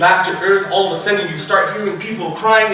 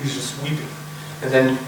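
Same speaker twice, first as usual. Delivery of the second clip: ordinary talk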